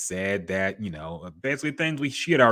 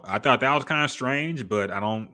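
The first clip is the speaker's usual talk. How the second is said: ordinary delivery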